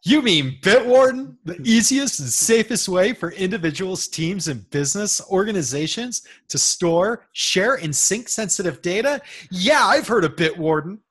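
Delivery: loud, overplayed commercial pitch; cheesy ad read. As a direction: sell it like a TV ad. Co-host performs the sponsor ad.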